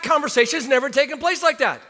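ordinary talk

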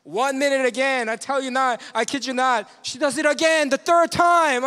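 worked up, telling it big; building to incredulous. Retold or imitated. Story builds to third time.

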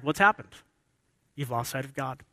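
ordinary delivery

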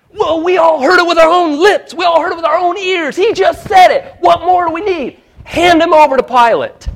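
high, loud mob yelling; furious crowd cry; angry council shout. Acted. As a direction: shout them down in outrage. Acting the council's outcry.

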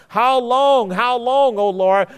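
high, loud plea repeated; crying out to God. Retold or imitated. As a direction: cry it out like a plea from under the altar. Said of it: "under the altar simply crying".

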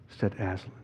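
ordinary talk, nothing marked

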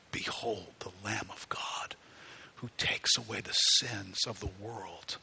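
slow, soft reverent whisper; John whispering to the crowd in awe; whispered behold. Retold or imitated.

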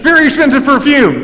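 preacher lighting up over the perfume; excited exclamation. Shown as a demonstration. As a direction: burst out with amazement at the cost. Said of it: "we gotta shift back to this".

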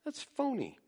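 put-on high, fast child's voice, scornful; mock child. Pastor voicing children rejecting a hypocritical father, mock scornful. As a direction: squeak it out like a fed-up kid. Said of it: "And your kids say".

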